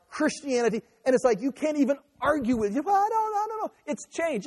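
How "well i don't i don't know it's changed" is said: high, fading mock shrug; mimicking a shrugging opponent, high and fading; mocking evasive voice. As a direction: play the weak dodge of the doubter.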